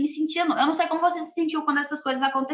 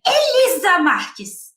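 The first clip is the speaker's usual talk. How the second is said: stretched-out shouted name, higher and louder; retold shout; 6 semitones higher, 5 dB louder, much slower than usual. Principal bursting into the classroom, yelling the teacher's name. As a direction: bark the full name slowly like an angry principal. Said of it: "abriu a porta gritando".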